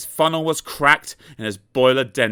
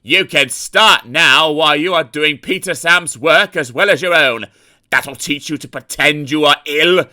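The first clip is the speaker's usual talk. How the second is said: louder, firm scolding; stern controller; 4 semitones higher, 7 dB louder than usual. A narrator's reading. Controller scolding Sir Handel for pretending, loud and firm. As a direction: lay down the punishment. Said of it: "said a stern voice".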